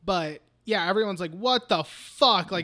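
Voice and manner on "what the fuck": slow, measured mock disbelief; appalled reaction. Everyone reacting to the sacrifice, slow appalled disbelief. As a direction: draw out each word in disbelief.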